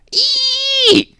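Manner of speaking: very high, loud, long piercing owl shriek; eerie shriek; owl screech. Acted. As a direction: screech like a barn owl calling its father. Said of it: "An eerie, long-drawn shriek".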